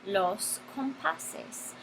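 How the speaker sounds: "los compases" drawn out very slowly and softly; slow teacher demo of the plural "compases"; slow modelling. Shown as a demonstration. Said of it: "vamos a decir los".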